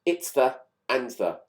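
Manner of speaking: usual speaking voice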